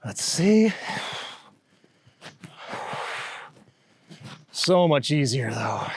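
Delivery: effortful, mid-climb; cycling hard. Real, not acted. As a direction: push through the climb as you talk. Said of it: "but still hard".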